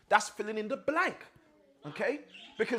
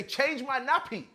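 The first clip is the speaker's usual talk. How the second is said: higher, slow mock-toddler demand; imitated demanding baby; 6 semitones higher, slower than usual. Teacher imitating a baby who wants changing, lightly demanding. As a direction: lightly mimic a small child's demand.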